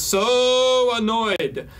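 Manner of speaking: hammed-up annoyance, stretching the word SO; exasperated. Reading out a mother's complaint, exasperated.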